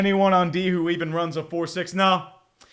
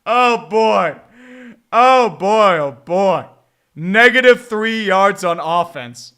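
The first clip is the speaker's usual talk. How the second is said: loud despairing fan; loud exasperation; 8 dB louder, slower than usual. Real, not acted. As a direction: vent at the hopeless offense. Exasperated at the team.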